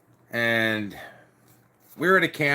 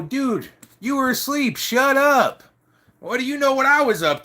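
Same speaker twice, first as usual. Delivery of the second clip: much higher, louder angry retort; retold angry shout; 12 semitones higher, 4 dB louder than usual. Telling off the sleeping prospect, angry shout. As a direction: yell back at him in a changed voice.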